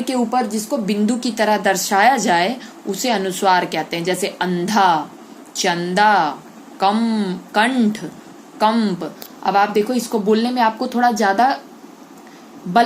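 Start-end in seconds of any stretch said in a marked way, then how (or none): 4.0-9.2 अंधा, चंदा with a full, forceful nasal ं; nasal anusvara demo. Shown as a demonstration. Teacher demonstrating anusvara words to pupils, emphatic. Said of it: "जैसे बोल के देखो".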